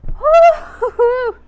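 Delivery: high, loud, slow woo-hoo; slow cheering whoop. Real, not acted. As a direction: celebrate the finish. Cheering at end of workout.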